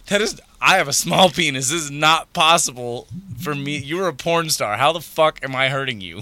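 a retold exasperated rant at the accusation; incredulous rant. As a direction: protest like it's absurd. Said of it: "And I'm like".

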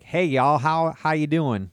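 mock Southern drawl; Southern US accent. Shown as a demonstration. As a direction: put on a folksy Southern greeting. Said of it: "Oh, South, South".

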